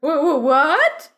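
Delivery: loud, high, slow stammer; jolted awake, stumbling over the word; waking from a daydream, startled. Acted. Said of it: "you ask, coming back to reality".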